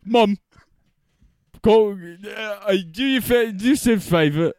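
put-on British schoolboy accent; mock British accent. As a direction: speak in a cheeky British accent. Mock British banter about Harry Potter.

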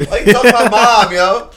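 high, loud, fast mock offense; mock outrage. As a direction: fire it back, playfully offended.

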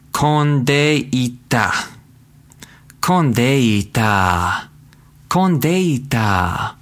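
Kondeita drawn out slowly, each syllable clear; voiceover demo. Shown as a demonstration. Teacher practicing voiceover on the new word, slow. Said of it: "practicing my voiceover skills here".

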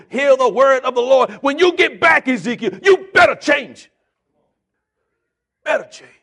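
building from steady to a loud shouted climax; preaching. Acted. Preacher as God rebuking Ezekiel, building to a shout.

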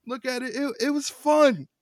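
high, loud "fun"; excited emphasis. Real, not acted. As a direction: push the word up with glee. Defending the match excitedly.

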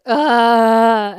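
loud, high anguished moan; sobbing moan. Retold or imitated. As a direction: let out a loud crying groan. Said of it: "outright moaning".

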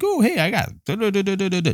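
high, breezy babble; mock careless. Mocking the idea of an easy eulogy, high and breezy. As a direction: toss it off like it's nothing. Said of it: "It's not like".

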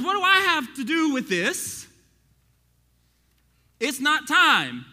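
high, loud, slow Jesus; high and loud, slowing on the last words; Jesus' emphatic reply. Acted. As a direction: draw out "It's not time" as Jesus. Preacher voicing Jesus.

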